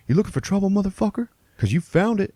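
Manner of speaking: high-pitched hot-headed threat; mock tough threat. Driver before pulling his gun, mock threatening. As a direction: imitate a punk picking a fight.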